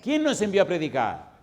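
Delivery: loud, high rhetorical question; preaching call. Real, not acted. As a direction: call it out to the crowd. Preacher calling out to congregation.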